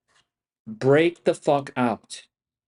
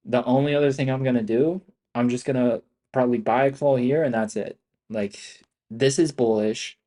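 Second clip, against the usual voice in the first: normal talking voice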